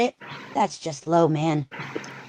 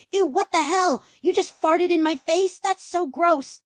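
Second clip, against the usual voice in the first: disgusted; 11 semitones higher than usual. Acted. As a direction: recoil in disgust. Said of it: "Gags and coughs".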